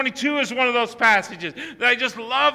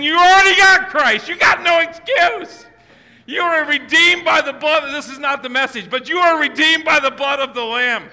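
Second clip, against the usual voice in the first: loud, higher preaching bursts; full-force preaching; 3 semitones higher, 4 dB louder than usual. Acted. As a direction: drive it home to the congregation.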